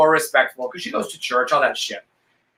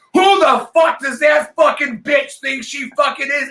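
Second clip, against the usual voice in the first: much higher, louder furious shout; going ballistic; 10 semitones higher, 3 dB louder than usual. Retold or imitated. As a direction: explode at her in a rage. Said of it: "And I go ballistic".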